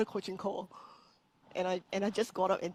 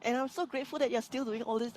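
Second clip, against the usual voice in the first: ordinary delivery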